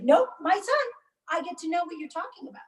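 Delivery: a lightly voiced husband brushing her off, fast; defiant husband. Mother voicing husband refusing to leave the room, defiant.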